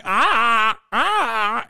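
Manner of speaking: high, loud dopey "ah, ah" with a strained catch; mock a nodding bobblehead stammering; bobblehead impression. Mocking a debate candidate's bobbling, high and loud. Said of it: "my fucking bobblehead who keeps going".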